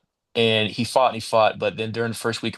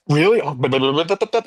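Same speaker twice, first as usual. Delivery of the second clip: higher-pitched startled babble; mock alarm rising higher; flustered reaction; 5 semitones higher, 5 dB louder than usual. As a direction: climb into wordless spluttering. Flustered reaction to missed call acted.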